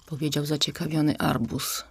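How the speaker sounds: ordinary delivery, nothing marked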